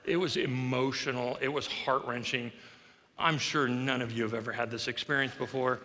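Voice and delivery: ordinary talk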